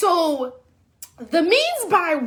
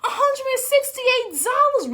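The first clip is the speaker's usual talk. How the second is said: outraged; 8 semitones higher than usual. Retold or imitated. Complaining to Uber, higher outraged voice. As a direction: stretch out the price in disbelief.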